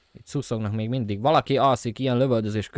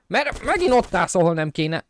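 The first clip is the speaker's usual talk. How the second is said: high, loud scolding at the screen; exasperated yell; 6 semitones higher, 4 dB louder than usual. Real, not acted. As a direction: yell it at the game, fed up. Yelling at game character. (felháborodott kiabálás)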